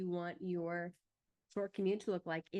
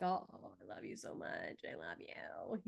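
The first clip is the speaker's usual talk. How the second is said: much lower, much softer loving coo; tender mom soothing; 12 semitones lower, 8 dB quieter than usual. Retold or imitated. Mom reassuring her son after his apology, tender low coo. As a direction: melt into a soft, low reassurance.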